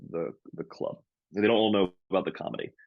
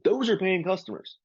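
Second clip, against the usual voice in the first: high mocking echo of the owner; mocking repeat; 11 semitones higher than usual. Comic mocking the owner's excuse back at him, high and sarcastic. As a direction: parrot it back sarcastically, pitch way up. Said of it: "I was like".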